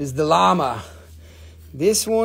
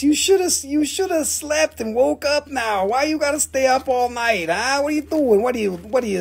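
high-pitched nagging tease; lightly hammed-up telling-off, pitched high; mock scolding; 7 semitones higher than usual. Real, not acted. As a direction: nag them playfully about staying up. Teasing a viewer for staying up.

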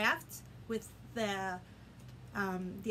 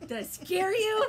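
playful teasing; 8 semitones higher, 9 dB louder than usual. Acted. After playful boo.

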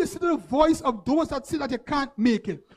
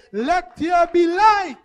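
higher, louder and slow, a commanding cry; thundering proclamation; 5 semitones higher, 7 dB louder, slower than usual. Acted. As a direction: proclaim it like God calling light into being. Climax of speaking light over congregation.

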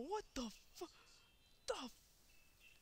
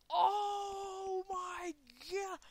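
slow, louder cry of dismay; drawn out shock; 5 semitones higher, 7 dB louder, much slower than usual. Real, not acted. Gamer as his character dies again, slow cry. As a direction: stretch it out in disbelief.

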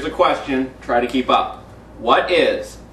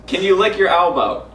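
normal talking voice

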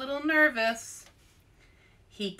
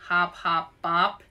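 a lightly sceptical hop hop bop; doubtful question; 4 dB louder than usual. A narrator's reading. Arnold questioning Louise's silly game, mildly doubtful. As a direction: repeat it back, unconvinced. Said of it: "Arnold raised one eyebrow".

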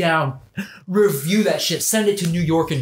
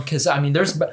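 usual speaking voice

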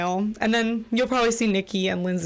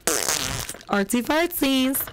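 drawn-out silly; 3 semitones higher, much slower than usual. Pretend. Slow drawn silly sign-off.

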